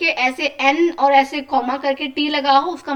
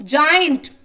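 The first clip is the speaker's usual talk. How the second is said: louder word read aloud; 5 dB louder than usual. Shown as a demonstration. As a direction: say it out loud for the class. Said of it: "अब आपको थोड़ा तेज तेज बोलना है". (ज़ोर से बोला गया शब्द)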